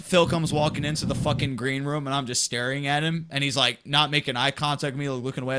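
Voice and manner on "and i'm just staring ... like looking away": ordinary delivery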